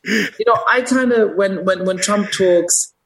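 high, hammed-up voice of a working-class Jersey guy; Jersey comedian's guy. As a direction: pitch it way up as the comedian's everyman. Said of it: "I'm not trying to do the accent".

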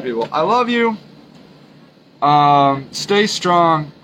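ordinary talk, nothing marked

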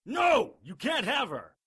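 high, loud defiant yell; angry shout. Acted. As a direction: shout to defend her. Harry charges the cannibal.

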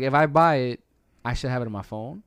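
ordinary talk, nothing marked

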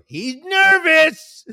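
high, loud, slow taunting drawl; teasing mock voice. Friends taunt the comic backstage, slow and loud. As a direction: stretch it out to mock him. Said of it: "you go".

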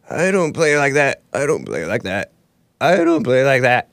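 lightly voiced imitation of the shortstop, his voice barely put on; a half-hearted impression of an offended player; imitating Tim Anderson. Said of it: "I'm not doing his voice justice".

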